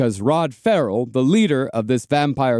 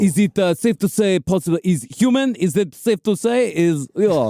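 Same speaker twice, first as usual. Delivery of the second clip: mock vampire kid; 3 semitones higher than usual. Parodying the kid's halting line.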